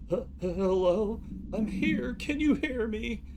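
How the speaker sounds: high, slow, lost woman's voice; a scared caller drifting away; frightened caller. Acted. Caller in transcript read aloud.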